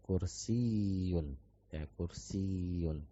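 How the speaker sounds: kursiyun said slowly with the ya doubled, kursiy-yun. Shown as a demonstration. Teacher modelling the tasydid in kursiyun for students. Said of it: "sebenarnya ada huruf tasdid ya".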